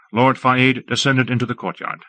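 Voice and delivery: usual speaking voice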